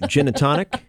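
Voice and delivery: lazy low hotshot pilot; airline pilot voice. Acted. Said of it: "You did the pilot voice".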